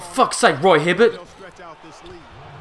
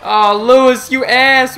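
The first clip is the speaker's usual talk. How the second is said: loud, higher exasperated jab; angry insult; 7 semitones higher, 10 dB louder, slower than usual. Real, not acted. Gamer berating his teammate Lewis, loud exasperated insult. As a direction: yell the insult at the screen.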